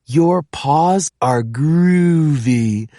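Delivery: slow, drawn-out Pete the cat voice. Acted. Pete admiring the six-toed cat, slowly. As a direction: savor each word like a cool cat.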